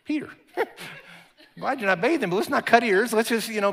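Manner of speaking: high, chuckling gentle rebuke; Jesus laughing at Peter, high and amused. Retold or imitated. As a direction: laugh it off while correcting him. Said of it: "And Jesus like".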